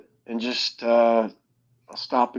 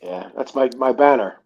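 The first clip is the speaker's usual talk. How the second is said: normal talking voice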